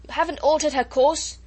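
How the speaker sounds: high, loud strained question; straining his voice. A narrator's reading. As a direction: strain to be heard over the storm. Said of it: "straining his voice".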